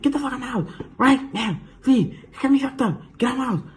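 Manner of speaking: very high mock screeching mom; frantic high-pitched tirade; spazzing out. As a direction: imitate a mother throwing people out in a fit. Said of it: "spazzing on her".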